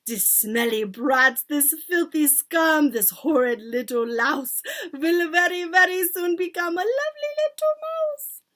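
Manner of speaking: slow, loud gloating tirade rising to a screech; venomous, gleeful witch cackling voice; witch shouting out. A narrator's reading. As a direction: spit the insults, ending very high. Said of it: "then she shouted out".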